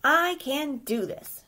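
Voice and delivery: plucky self-encouragement; determined exclaim. Acted. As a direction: push through it, I can do this. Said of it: "she exclaimed".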